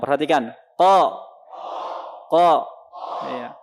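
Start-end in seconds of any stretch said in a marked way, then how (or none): 0.7-1.1 "Ko" from the back of the tongue, lips open; Qaf without pouting. Shown as a demonstration. Teacher models qaf for students, unpouted "Ko". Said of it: "hanya terbuka tanpa mo-, monyong". 2.3-2.7 "Ko" from the back of the tongue, lips open; Qaf without pouting. Shown as a demonstration. Teacher models qaf for students, unpouted "Ko". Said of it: "hanya terbuka tanpa mo-, monyong".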